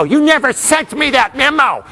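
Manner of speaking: hammed-up grudge outburst; mock accusation. Speaker acting a colleague holding a grudge, mock indignant.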